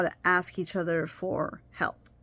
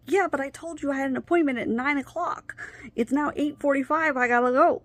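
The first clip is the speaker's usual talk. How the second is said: higher, louder mock frustration; retold exasperated protest; 7 semitones higher, 3 dB louder than usual. Wife telling husband she'll be late, mock exasperated. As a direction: build the complaint to a louder finish. Arguing she has an appointment.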